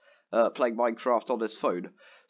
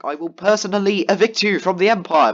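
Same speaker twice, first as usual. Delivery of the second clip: angry threat; 4 semitones higher, 10 dB louder than usual. Retold or imitated. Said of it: "I was quite angry by this point".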